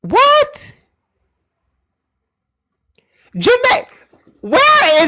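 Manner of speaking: high, loud disbelief; incredulous yell. Real, not acted. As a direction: shout it in shocked disbelief. Incredulous at geography error.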